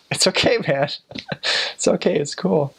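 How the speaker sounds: high, laughing easy brush-off; mock breezy reply. Missionary to the old cabbie, high laughing brush-off. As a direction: shrug it off, chuckling. Easygoing reply to taxi driver.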